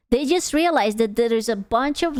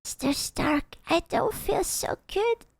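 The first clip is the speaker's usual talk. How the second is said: much higher and softer, a fading mock plea; dying Spider-Man quote; 8 semitones higher, 6 dB quieter than usual. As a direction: whimper it like a hero turning to dust. Quoting dying Spider-Man meme.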